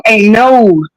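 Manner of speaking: ordinary delivery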